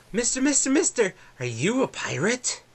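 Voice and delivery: put-on high eager child voice; excited little boy. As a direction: squeak it out like a wide-eyed kid. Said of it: "two little boys run up to you".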